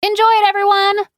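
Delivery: loud, high excited shout; yelling. A narrator's reading. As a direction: shout it out to everyone. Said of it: "Lily yelled".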